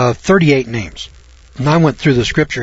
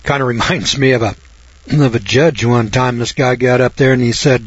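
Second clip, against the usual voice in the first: usual speaking voice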